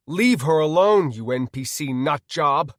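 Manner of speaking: loud shout, easing at the end; angry scream. Acted. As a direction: yell at him to back off. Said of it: "I screamed".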